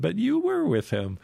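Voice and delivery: insistent accuser. Retold or imitated. Another person challenges Peter's denial, insistent mock accusation.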